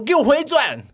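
high loud mock order; loud high insistent command; grabbing demand. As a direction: yank his shoulder and demand it. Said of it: "她说".

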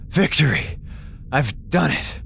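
normal talking voice

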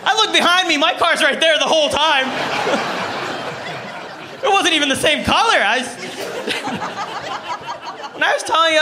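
cracking up at his own mistake; laughing. Real, not acted. Telling the crowd about the wrong car, laughing.

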